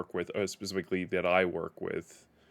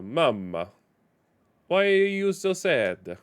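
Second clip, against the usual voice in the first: put-on slow, higher, louder Italian accent; 6 semitones higher, 4 dB louder, slower than usual. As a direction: ham up an Italian accent, pleading. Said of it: "I shouldn't do Italian accents".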